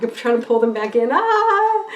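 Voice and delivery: fighting back tears, then a sudden loud cry; a hammed-up panicked cry; mock panic. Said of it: "And you're like".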